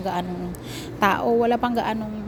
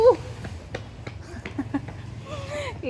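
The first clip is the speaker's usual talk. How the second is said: high breathy laugh while running; breathless laugh; 4 dB quieter than usual. Real, not acted. (hingal na tawa)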